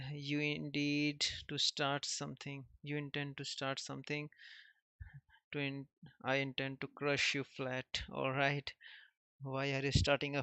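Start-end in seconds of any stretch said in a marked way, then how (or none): none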